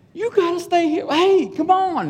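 high, strained call to a dog; high-pitched, drawn-out exasperation; exasperated pleading. Retold or imitated. Exasperated, calling his straying dog.

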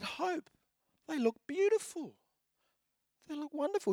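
high, soft gushing; doting dad. Retold or imitated. Dad admiring his little girl's shoes, high soft gushing.

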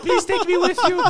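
high, loud mock plea; comic high-pitched hostage dog; pleading dog's voice. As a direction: voice a dog begging to be rescued.

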